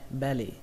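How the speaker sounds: "belly" stressed on BE, falling on -lly; first-syllable stress. Shown as a demonstration. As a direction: hit BE in "belly", let the end drop. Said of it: "trọng âm của nó rơi vào âm đầu tiên". (đọc "belly" nhấn vào âm "be")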